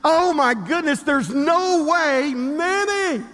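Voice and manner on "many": "Many" called out high and drawn; excited "many". Shown as a demonstration. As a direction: rufe "Many" hoch und gedehnt. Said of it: "I thought you'd get more excited about that word".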